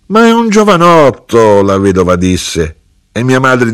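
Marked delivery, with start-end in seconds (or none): 0.1-1.6 very high, loud objection; shrieked protest. A narrator's reading. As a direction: cry out in scandalised protest. Widow protests being seen by him.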